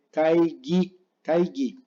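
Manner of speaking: "kaigi" as kai-gui; slow "kaigi", hard g in the last syllable; Japanese hard G. Shown as a demonstration. Teacher models another word, "kaigi" as kaigui. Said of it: "se lee kaigi".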